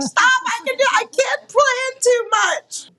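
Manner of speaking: high, slow exaggerated protest; mock protest. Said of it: "I'm like".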